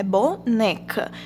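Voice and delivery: boneca broken slowly, bo-NE-ca; stress on NE in boneca. Shown as a demonstration. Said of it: "a sílaba tônica é o né". (boneca devagar, tônica no "ne")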